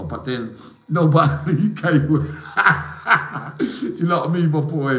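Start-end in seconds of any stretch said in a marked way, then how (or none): 2.3-3.9 sudden high laugh; laughing out loud, high and loud; high burst of laughter. Real, not acted. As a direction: break into a bright laugh. Laughs between remarks.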